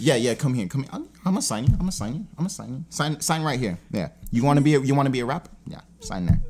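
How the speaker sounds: low, soft, fast patter; furtive, rapid low-voiced recruiter; hurried coaxing. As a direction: hustle him quietly into signing. Acted boss hustling someone to sign.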